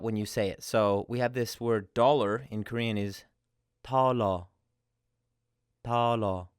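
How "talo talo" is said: talo with a light T, little air let out. Shown as a demonstration. Teacher demonstrates the Korean word for dollar. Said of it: "a very light T sound at the beginning".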